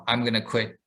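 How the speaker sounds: usual speaking voice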